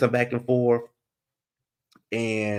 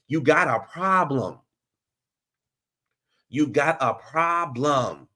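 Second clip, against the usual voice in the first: emphatic scolding; 5 semitones higher, slower than usual. Real, not acted. Scolding Karen about her drinking.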